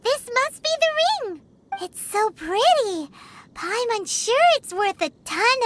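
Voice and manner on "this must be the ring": excited. Acted. Finding the lost ring.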